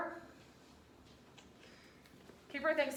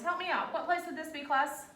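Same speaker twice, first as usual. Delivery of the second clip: higher, louder prompting; rallying the class; 6 semitones higher, 5 dB louder than usual. Real, not acted. Teacher urging whole class to answer aloud. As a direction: pull everyone into a chorus.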